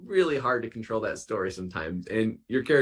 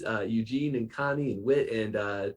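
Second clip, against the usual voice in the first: usual speaking voice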